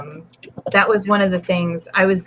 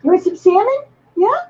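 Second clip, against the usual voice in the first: much higher, sing-song pet talk; 13 semitones higher, 4 dB louder than usual. Real, not acted. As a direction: tease the dog sweetly, pitched way up. Teasing the dog with salmon.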